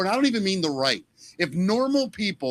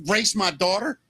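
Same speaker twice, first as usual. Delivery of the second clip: ordinary talk, nothing marked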